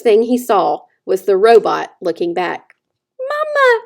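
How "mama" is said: put-on high, tiny baby-bird peep; a high, tiny newborn peep calling for mama; tiny peeping gosling. A narrator's reading. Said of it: "peeped the gosling".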